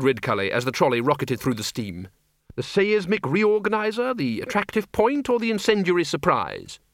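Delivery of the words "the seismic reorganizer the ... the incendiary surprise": high, relishing list of options; eager Dean. A narrator's reading. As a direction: offer each weapon eagerly, pitched up. Dean lists his spells.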